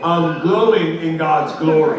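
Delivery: loud, drawn-out declaration; hammed-up blissful boast; exultant proclamation. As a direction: proclaim it slowly, basking in praise.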